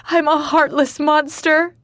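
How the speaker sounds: high, loud wail of self-hatred. Acted. Katya wails to Ford she is a monster, high and loud. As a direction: wail it out in anguish. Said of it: "I wail".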